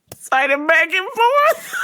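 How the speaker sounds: high, slow words rising into laughter; laughing as the pitch climbs; cracking up. Real, not acted. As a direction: struggle to say it through rising laughter. Cracking up while retelling.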